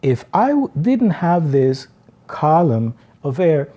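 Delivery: ordinary talk, nothing marked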